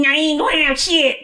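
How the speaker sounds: high snapping grandmother; grandma voice. Retold or imitated. Grandma denies her grandson a biscuit in a high sharp voice. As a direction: refuse him sharply, high old-lady voice. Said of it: "do her voice".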